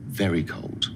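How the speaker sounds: ordinary delivery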